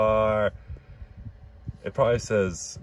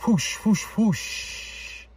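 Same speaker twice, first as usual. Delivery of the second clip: whoosh sound effect; 12 semitones higher, much slower than usual. Pretend. Mouthing comic sound effects.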